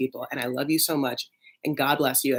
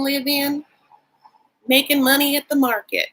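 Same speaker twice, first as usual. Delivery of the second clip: Southern mom; 9 semitones higher than usual. Retold or imitated. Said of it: "She's like".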